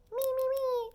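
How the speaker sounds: squeaky high mock voice; mock high quote. Mocking a greedy virus in a squeaky high voice. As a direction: pitch it way up, mocking.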